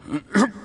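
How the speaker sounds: a sharp cough as the poison hits; cough, caught off guard; startled cough; sudden choking cough. Acted. Poison starts to take effect.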